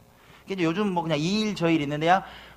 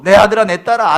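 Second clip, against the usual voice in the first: God calling out; 5 semitones higher, 14 dB louder than usual. Acted. Voicing God calling children.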